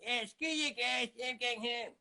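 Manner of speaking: high-pitched cartoon brat; Eric Cartman. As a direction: squawk it high like a spoiled cartoon kid. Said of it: "Eric Cartman".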